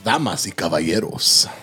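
lucha libre announcer. Acted. Co-host echoes intro in Spanish.

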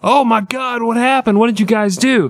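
high panicked manager; panicked shock. Retold or imitated. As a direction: gasp it out, horrified. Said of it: "that manager shows up and goes".

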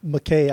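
[name] ending like the word "day"; [name] as mac-KAY. Shown as a demonstration. Answering co-host on the name, [name] said muh-KAY. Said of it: "I would say".

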